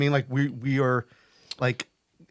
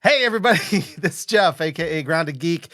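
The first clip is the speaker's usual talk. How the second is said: show-opening greeting; 2 semitones higher, 4 dB louder than usual. Real, not acted. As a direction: open the show big and bright for the audience. Host opens the show.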